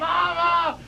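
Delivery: put-on high child's wail; imitated trapped child. Imitating four-year-old calling from inside the wall, high cry. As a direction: cry out high for help, as a little kid.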